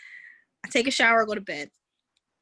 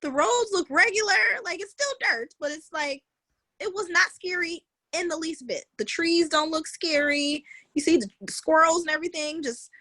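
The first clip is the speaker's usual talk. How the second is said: relieved musing; 8 semitones higher than usual. Retold or imitated. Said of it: "and I go".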